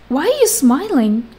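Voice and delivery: normal talking voice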